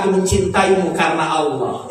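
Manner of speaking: low, tender husband's voice; tender declaration. Retold or imitated. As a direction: confess love in a hushed low pitch. Voicing Prophet's loving words to wife. (pernyataan cinta lembut bernada rendah)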